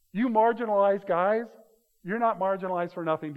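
mock the crowd telling them off; mock scolding. Crowd telling blind men to shut up, mock scolding.

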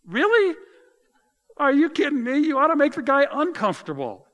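can you believe this guy; real exasperated disbelief at Jonah; incredulous outburst. Real, not acted. Preacher exasperated at the story.

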